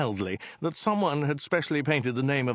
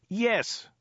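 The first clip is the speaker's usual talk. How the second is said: high, insistent yes; mild high-pitched shout; 10 semitones higher than usual. A narrator's reading. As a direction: call it out insistently. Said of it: "shouted Dirk".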